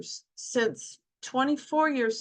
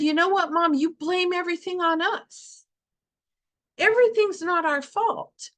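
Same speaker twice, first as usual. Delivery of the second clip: higher, insistent teasing sons' voice; mock scolding kids, pitched up; sons scolding mom; 6 semitones higher, 4 dB louder than usual. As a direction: voice the boys gently scolding their mother. Sons scolding mom in retold vision.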